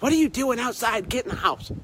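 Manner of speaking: playful put-on scolding; mock nagging wife. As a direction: imitate your wife ordering you indoors. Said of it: "She's like".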